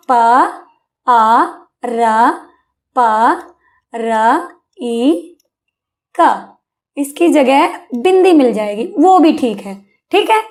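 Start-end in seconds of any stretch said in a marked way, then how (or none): 0.1-6.5 प आ र प र ई क sounded one by one with pauses; slow letter-by-letter dictation of प आ र प र ई क; spelling out letters. Shown as a demonstration. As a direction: spell पारंपरिक letter by letter for a child. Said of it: "पारंपरिक कैसे लिखा मिलेगा?".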